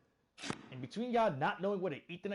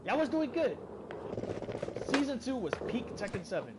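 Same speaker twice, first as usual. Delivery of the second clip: exasperated rant; 6 semitones higher than usual. Real, not acted. Player yells at the Tekken devs in outrage. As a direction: demand answers from the devs. Ranting at the game's makers.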